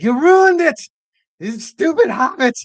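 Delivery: high, loud, hissing Gollum rant. Retold or imitated. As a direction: screech it like Gollum losing his fish. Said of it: "I always think of, uh, Gollum".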